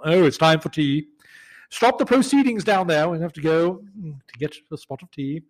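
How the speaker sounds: hammed-up British alarm over teatime; polite British fan. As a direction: play a tea-loving British sports fan. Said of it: "are British fans in American football".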